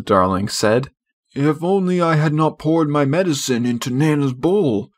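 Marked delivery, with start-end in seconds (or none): 1.3-4.9 a touch higher, a hint of a rueful father; wistful regret, understated; lightly voiced regretful father. A narrator's reading. Father regrets poisoning the dog's bowl, lightly voiced rue. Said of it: "said Mister Darling".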